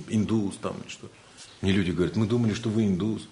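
usual speaking voice

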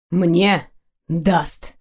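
understated growl of an answer; growled retort. A narrator's reading. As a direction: growl it back, lightly acted. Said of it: "прорычал в ответ".

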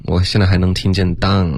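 usual speaking voice

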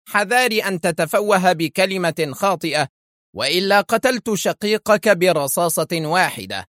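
high-pitched, sharp threat; sharp cry. A narrator's reading. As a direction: warn him sharply, voice pitched well up. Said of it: "هتف شارل في حدة". (هتاف حاد مهدد)

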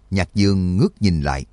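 usual speaking voice

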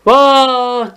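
long, held cry of woe, play-acted; mock lament. Teacher acting a mourner receiving the death news, mock lament. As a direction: stretch "Vah" into one long mock wail. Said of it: "Ağlıyor".

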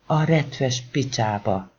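ordinary delivery, nothing marked

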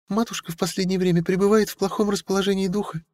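tiny chirping voice. A narrator's reading. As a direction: chirp it in a thin voice. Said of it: "тоненьким голосом прощебетала она".

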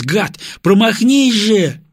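high, loud, drawn-out pleading cry; desperate plea. A narrator's reading. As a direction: beg the bomb to miss, slowly. Praying the bomb misses.

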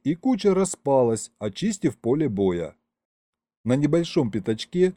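ordinary delivery, nothing marked

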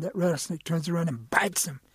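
ordinary delivery

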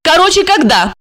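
high, impatient female question; decisive woman cutting to the point; resolute demand. A narrator's reading. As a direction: press for an answer, pitched high. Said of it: "решительно спросила Регина".

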